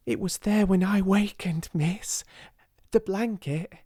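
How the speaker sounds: can barely contain the news; excited whisper. A narrator's reading. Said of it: "she whispered excitedly".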